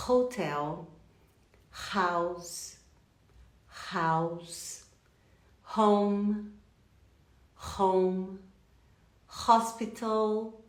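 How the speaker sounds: repeat-after-me word list, each H clearly aspirated; measured drill, every H sounded like a Portuguese initial R; H pronounced. Shown as a demonstration. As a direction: model "House", "Home", "Hospital" one at a time with breathy H. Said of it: "Repete comigo".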